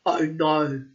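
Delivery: dismayed. Acted. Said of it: "he roared".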